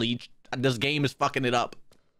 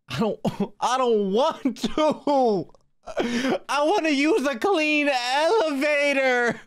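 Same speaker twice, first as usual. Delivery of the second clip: high, slow, drawn-out wail of refusal; dragged-out whine; 10 semitones higher, 3 dB louder, much slower than usual. Real, not acted. Player balking at taking the emergency stairs, high slow whine. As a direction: whine it long and high, stretching the words.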